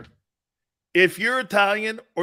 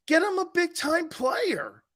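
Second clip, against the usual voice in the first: higher, slow, drawn-out demand; emphatic plea; 9 semitones higher than usual. Real, not acted. To the chat about the quarterback, a slow pleading demand. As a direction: press the point once more.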